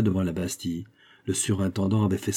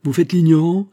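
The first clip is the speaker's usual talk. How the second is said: much higher and louder, scornful challenge; scornful outburst; 8 semitones higher, 9 dB louder than usual. A narrator's reading. Minister accusing the governor of feigning ignorance, loud scorn. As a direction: throw the accusation in his face.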